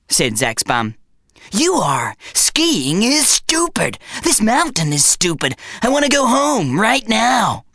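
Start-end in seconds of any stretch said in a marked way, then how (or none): 1.5-7.7 petulant bum. A narrator's reading. Bum demanding to go home.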